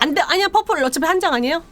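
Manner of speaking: high, bright no-no; quick protest. Real, not acted. Speaker protesting to a caller, high and quick. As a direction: brush off the offer.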